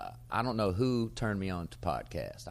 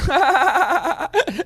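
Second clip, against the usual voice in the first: real high-pitched belly laugh; loud high cackle; 14 dB louder than usual. Real, not acted. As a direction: burst out laughing at your own joke. Laughing at his own pool joke.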